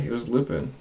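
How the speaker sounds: usual speaking voice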